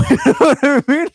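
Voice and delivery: laughing through the words. Real, not acted. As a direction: chuckle it out. Laughing at own joke.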